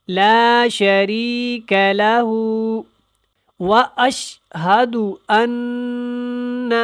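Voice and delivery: slow, drawn-out recitation with clear letters; careful tajweed recitation. Shown as a demonstration. Teacher demonstrating the kalima to learners, slow tajweed recitation. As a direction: recite slowly, stretching each word. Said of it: "اس کا دھیان رکھنا ہے".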